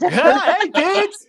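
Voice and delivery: high, loud, laughing creepy clown. Acted. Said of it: "sounds like a creepy clown".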